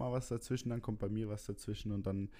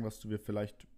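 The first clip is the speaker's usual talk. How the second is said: ordinary talk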